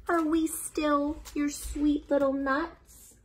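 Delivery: put-on soft, high little-child question; small worried voice. Child asks mama, soft and small. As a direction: ask it timidly, like a tiny child.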